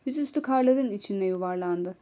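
ordinary delivery

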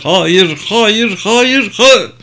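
high, rising cries of denial; acted tearful protest, climbing in pitch; tearful refusal. A narrator's reading. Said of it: "dedi Jean ağlayarak".

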